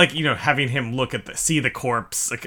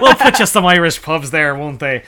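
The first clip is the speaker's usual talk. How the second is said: mock planners; 4 semitones higher, 7 dB louder than usual. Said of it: "they're like".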